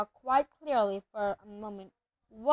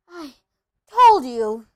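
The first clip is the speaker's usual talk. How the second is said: loud, breathless outburst on "told"; panting out a reproach; gasped; 3 semitones higher, 14 dB louder, slower than usual. A narrator's reading. Hermione gasps to her friends after running, gasped and forceful. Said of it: "Hermione gasped".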